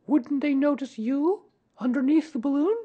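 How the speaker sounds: put-on very high questioning child; child's voice. A narrator's reading. Boy questioning Pooh's balloon plan, high child's voice. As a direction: pipe up as a puzzled little boy.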